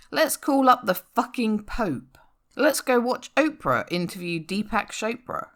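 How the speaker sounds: normal talking voice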